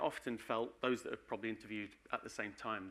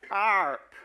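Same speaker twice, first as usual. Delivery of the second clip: arp said high and loud like a West Country arr; Wessex accent; 9 semitones higher, 12 dB louder than usual. Shown as a demonstration. Presenter naming his framework, loud Wessex arp. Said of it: "say it in the County of Wessex accent".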